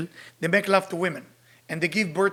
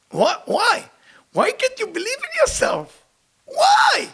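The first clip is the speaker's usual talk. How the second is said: exasperated why; slower than usual. Real, not acted. Exasperated challenge to spies.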